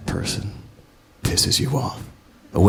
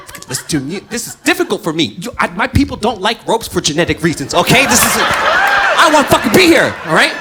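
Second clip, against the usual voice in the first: higher and louder, building to a yell; exasperated plea; 14 semitones higher, 8 dB louder than usual. Retold or imitated. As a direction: beg her to listen, losing it. His pleading in quarrel.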